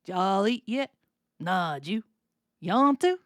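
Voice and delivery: run-together Southern exchange, questions rising; Southern US dialect. Shown as a demonstration. Teacher going back over the two-person bit, Southern accent. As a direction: slur the words together like a Southerner. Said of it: "let's go back over it".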